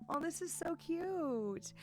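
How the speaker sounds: high, slow cooing aww; gushing aww. Real, not acted. A dad cooing at his sad daughter, high and drawn out. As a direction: coo it out like melting at a sad puppy face.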